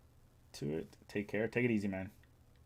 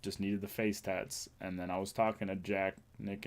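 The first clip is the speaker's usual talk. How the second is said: ordinary talk, nothing marked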